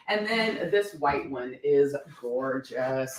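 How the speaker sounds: normal talking voice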